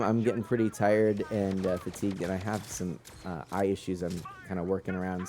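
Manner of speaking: ordinary delivery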